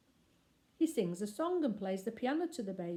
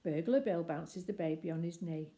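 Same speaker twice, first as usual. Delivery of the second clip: ordinary delivery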